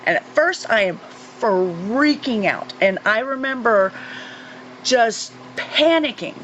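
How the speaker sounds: normal talking voice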